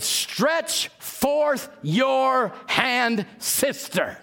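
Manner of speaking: very slow, drawn-out command; slow healing command. Retold or imitated. Preacher telling the crippled woman to stretch out her hand: slow, solemn command.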